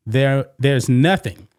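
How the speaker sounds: usual speaking voice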